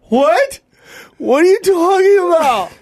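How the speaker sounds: high, loud outraged what; disbelieving yelp at the news; shocked disbelief. Retold or imitated. Outraged disbelief at being jailed.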